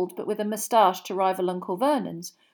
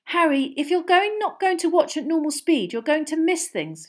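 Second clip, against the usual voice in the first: put-on a higher, lightly raised girl's shout; 8 semitones higher, 4 dB louder than usual. A narrator's reading. Hermione shouts at Harry over the crowd, mildly raised. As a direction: call out over the crowd noise. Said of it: "shouted Hermione".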